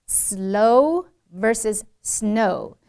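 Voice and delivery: "Slow" and "snow" drawn out very slowly; distinct l in "Slow", n in "snow"; L against N contrast. Shown as a demonstration. Teacher contrasting L and N, very slow and distinct. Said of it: "了呢不分".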